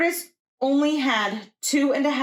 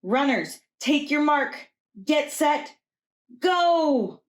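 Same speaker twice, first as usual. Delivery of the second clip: starting the race; 6 semitones higher, slower than usual. Acted. Rooster starts the race for the runners, rising call. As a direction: count down the start, rising to a cry. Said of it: "Rooster read aloud the rules".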